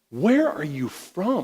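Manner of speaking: hammed-up Roman governor, drawn out; Pilate demanding.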